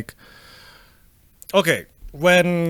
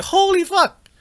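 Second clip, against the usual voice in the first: amazed; 12 semitones higher, slower than usual. Retold or imitated. Retold amazement at it working.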